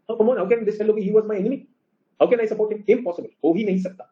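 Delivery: high-pitched, exasperated protest, acted out; indignant refusal. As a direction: play someone scoffing at the idea of forgiving. Acts out an unforgiving left brain.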